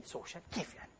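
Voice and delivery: breathy whisper, almost to himself; hushed puzzled whisper to the audience; whispered aside. Acted. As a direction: whisper the puzzled question as if wondering alone.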